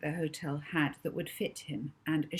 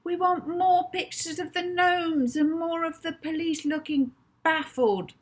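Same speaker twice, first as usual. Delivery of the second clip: loud and much higher, eager demand; excited boy shouting demands at the news; 11 semitones higher, 5 dB louder than usual. Acted. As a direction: keep yelling at the screen. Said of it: "Rocky shouted at the T V".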